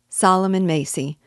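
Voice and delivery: plain reading voice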